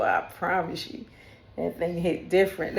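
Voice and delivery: usual speaking voice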